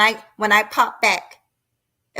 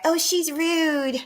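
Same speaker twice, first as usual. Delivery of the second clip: mocking chat voice; 6 semitones higher than usual. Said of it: "I saw people in the chat".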